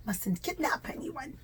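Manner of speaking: ordinary delivery, nothing marked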